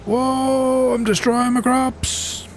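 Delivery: high, drawn-out alarm; alarmed whoa. Real, not acted. Player sees he is destroying his crops, high-pitched alarm.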